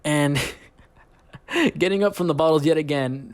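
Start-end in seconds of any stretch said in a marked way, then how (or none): none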